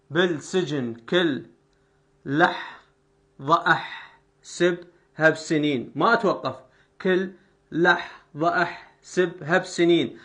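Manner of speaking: slow, drawn-out verse scansion; slow scansion reading. Shown as a demonstration. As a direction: stretch each syllable for metre. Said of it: "يعني هنا الهاء ما نطقتها".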